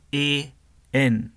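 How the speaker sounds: normal talking voice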